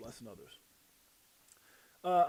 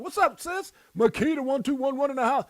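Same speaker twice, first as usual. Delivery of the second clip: high, loud greeting yell; hype shout-out; 10 semitones higher, 11 dB louder than usual. Real, not acted. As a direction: call them out like an MC. Shout-outs to viewers.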